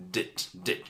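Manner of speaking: "Dit, dit" whispered without voice, clipped. Shown as a demonstration. Teacher isolating the ending sound, whispered. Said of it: "Need it".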